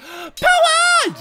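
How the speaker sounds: high, loud roar after a gasp; gasp, then bellow the attack name way up high; battle shout. Pretend.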